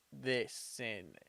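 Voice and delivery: normal talking voice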